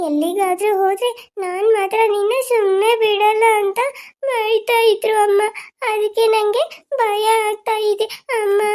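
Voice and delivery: high, slow, crying complaint to her mother; scared girl sobbing out her fear; tearful child. Acted. Frightened child cries to mother.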